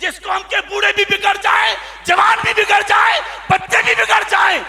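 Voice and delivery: high, very loud chanted preacher's cry; fiery preaching. Acted. Preacher lamenting a ruined nation, at full force. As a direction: cry it out to the whole crowd.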